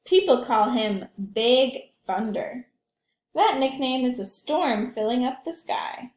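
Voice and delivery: lively picture book read aloud; gently animated storytime voice; reading to children. A narrator's reading.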